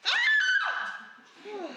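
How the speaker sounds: piercing high shriek; announced scream. Acted. A daughter screams to vent her rage, a shrill shriek. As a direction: let out a huge high scream. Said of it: "là, je vais crier".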